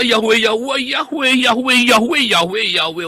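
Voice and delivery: high, quick, excited repetition; mock delighted chatter. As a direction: rattle it off high like happy chanting. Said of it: "wah, dia senang".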